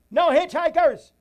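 loud preaching shout. Real, not acted. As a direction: hit it hard and loud.

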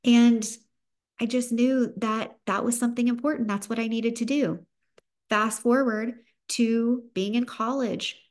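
usual speaking voice

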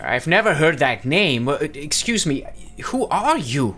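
put-on high, hesitant old man voice; frail old man. Old dentist questioning the agent, high and wary.

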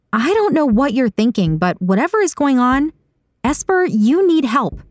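high-pitched, concerned confrontation; urgent concern in a high character voice; worried insistence. A narrator's reading. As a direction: press a friend to get help, voice high. Saga worried, urges Esper to seek help.

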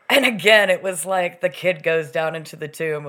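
normal talking voice

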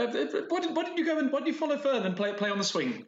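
high-pitched flustered camp counselor; high shooing voice. Acted. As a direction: shoo the kids off, sweetly strained. Counselor shoos kids, high voice.

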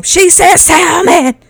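loud, high, drawn-out mocking yell; mocking group shout. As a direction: yell it like a roomful jeering someone's pronunciation. Retelling whole room mocking her pronunciation.

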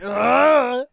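high, loud mock retching yell; hammed-up "Ugh!" of someone throwing up; imitating a man who screams as he vomits; imitated retching scream. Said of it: "he just screams".

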